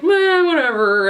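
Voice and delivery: tossed-off mock indifference; a lightly voiced bored "meh"; dismissive meh. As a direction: shrug it off like nothing matters. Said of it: "it was just sort of like".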